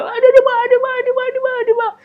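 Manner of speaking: put-on child crying ouch. Child's ear being pulled.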